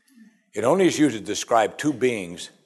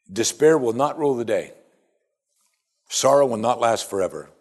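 ordinary delivery

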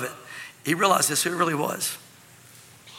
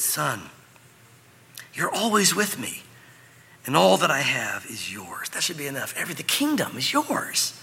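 gentle father; 5 semitones lower than usual. Acted. Father soothing his older son, gentle and unhurried. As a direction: reassure him tenderly, taking your time.

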